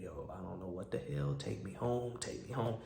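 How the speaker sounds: very soft, under the breath; low muttered plea. Retold or imitated. Passenger wanting to go home, muttered plea.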